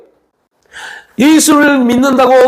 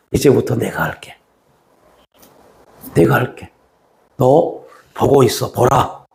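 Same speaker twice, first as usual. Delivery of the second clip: much lower and softer, quiet assurance; a hushed promise from God; God speaking; 9 semitones lower, 3 dB quieter, slower than usual. Retold or imitated. Preacher voicing God reassuring the believer, low and soft. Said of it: "그리고 말씀합니다".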